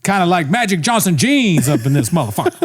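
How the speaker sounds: high-pitched slangy parody; mock host riff. Mimicking the host's overblown similes.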